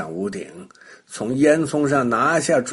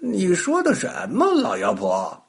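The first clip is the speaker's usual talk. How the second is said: feigned innocence; 9 semitones higher than usual. A narrator's reading. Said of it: "小白兔装作不懂".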